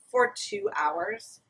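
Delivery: for two hours drawn out slow and high; drawn-out exaggeration. Real, not acted. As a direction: stretch "for two hours" in disbelief.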